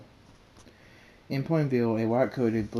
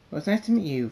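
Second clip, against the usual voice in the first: lightly voiced Pinkie Pie; 7 semitones higher, 6 dB louder, faster than usual. A narrator's reading. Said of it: "she says, and hops off".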